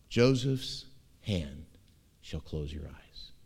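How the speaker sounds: slow, soft, fading to near silence; God comforting old Jacob, softly; tender and hushed. Acted. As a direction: let it fall gently like a comfort. Tender promise to dying father.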